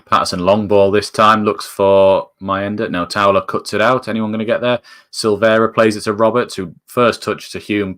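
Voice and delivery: live play-by-play. Acted. Fan calling the Sunderland build-up live, steady commentary. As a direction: call the build-up calmly but alert.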